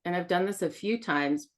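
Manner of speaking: ordinary talk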